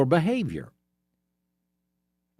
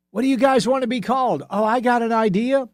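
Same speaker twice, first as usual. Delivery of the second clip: high, louder, quick imitation of people brainstorming; mock eager brainstormers; 8 semitones higher, 3 dB louder than usual. Officials asking each other for a name, mock eager. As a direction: play an over-keen committee member, pitched up.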